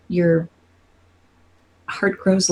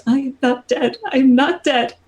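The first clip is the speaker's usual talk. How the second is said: a repeated higher mantra; whispered mantra; 8 semitones higher than usual. Acted. Goddess repeating her mantra to herself. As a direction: chant it like an old prayer. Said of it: "as though it's been a mantra that she's been whispering".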